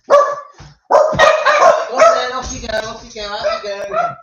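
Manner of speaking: high, coaxing call to a pet; talking to dog. Real, not acted. Shooing the dog out.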